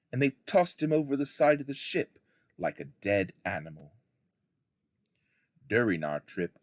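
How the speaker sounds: ordinary delivery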